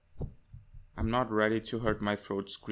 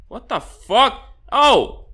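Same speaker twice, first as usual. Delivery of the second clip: loud, high startled curse; startled shout; 9 semitones higher, 11 dB louder, much slower than usual. Real, not acted. Gamer startled, loud curse. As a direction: blurt it out.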